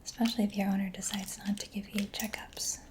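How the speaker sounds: ordinary talk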